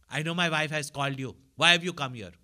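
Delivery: loud, hostile retort at the visitor; angry outburst. Retold or imitated. Depressed husband to the Swami, loud angry shout. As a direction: shout it, furious that he came.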